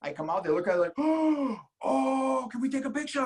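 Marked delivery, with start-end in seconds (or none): 1.0-1.8 high, exaggerated gasp of astonishment; hammed-up intake of breath; mock amazed gasp. Acts engineers seeing the yellow car. 1.8-3.2 high-pitched, badly imitated Japanese accent. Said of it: "a really bad accent of a Japanese person".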